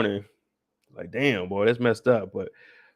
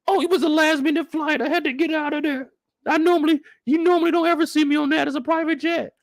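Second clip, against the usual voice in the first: much higher, prissy celebrity voice; mock Shelby defending herself; 13 semitones higher than usual. Shelby explaining online why she flew economy, high mock diva voice. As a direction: play the defensive diva excusing economy class. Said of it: "She gonna have to defend herself".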